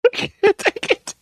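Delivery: high, slow words squeezed through laughter; giving up through laughter; laughing, can't take it. Real, not acted. Commentator overcome by laughter at the judge's name, laughing.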